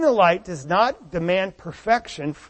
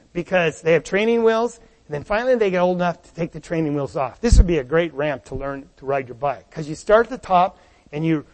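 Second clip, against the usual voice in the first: ordinary talk, nothing marked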